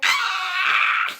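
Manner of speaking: high squealing shout of joy; excited squeal. Retold or imitated. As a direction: squeal with delight. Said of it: "And I was like".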